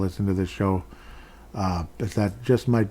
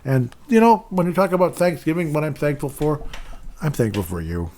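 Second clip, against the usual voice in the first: excited thanks; 6 semitones higher, 3 dB louder than usual. Real, not acted. Heartfelt thanks to listeners.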